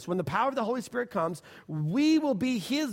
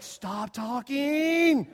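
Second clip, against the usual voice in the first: mock exasperation; 6 semitones higher, much slower than usual. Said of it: "I'm like".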